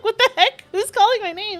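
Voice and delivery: high, loud mock bewilderment; startled. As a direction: re-enact a baffled double take. Re-enacting being startled by her name.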